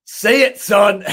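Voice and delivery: egging him on, loud and high; mock cheer. Real, not acted. Cohost egging on the story, loud and high. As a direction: shout it like a fan.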